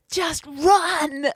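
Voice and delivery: high, loud acted yell; retold panicked shout. Storyteller ordering three frightened kids to flee, acted shout. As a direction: cry out as if fleeing a chainsaw man.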